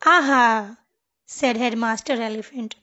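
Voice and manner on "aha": loud, storybook "aha" of discovery; big, pleased headmasterly aha; triumphant aha. A narrator's reading. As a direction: exclaim it as if you've found the answer.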